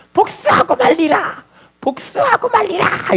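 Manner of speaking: very high, slow, drawn-out cry; hammed-up howl of revenge, stretched out; vengeful vow. Wrongly jailed son to his mother, anguished vengeful vow.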